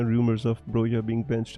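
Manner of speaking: ordinary talk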